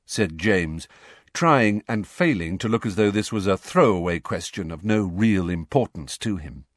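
ordinary talk

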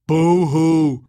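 loud, high mock wail. A narrator's reading. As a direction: jeer with a fake sob. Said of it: "said Frogface mockingly".

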